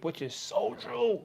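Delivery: soft amazed aside; awed agreement. Real, not acted. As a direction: savour how true it is.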